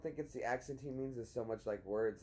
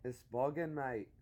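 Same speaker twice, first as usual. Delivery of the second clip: matey British slang accent; slower than usual. Shown as a demonstration. Showing her how it's said, British accent. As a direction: play up the British accent on "mate". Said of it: "you say it with an accent".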